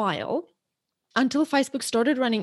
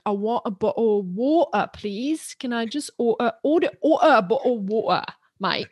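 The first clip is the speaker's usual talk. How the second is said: put-on London accent with dropped t's. Shown as a demonstration. Showing the host the slang picked up in London, London accent. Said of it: "I lived in London for three years".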